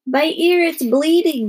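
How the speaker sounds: put-on high, tearful child's complaint; snuffling. A narrator's reading. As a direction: snuffle it like a stuffed-up crying child. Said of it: "Melody snuffled".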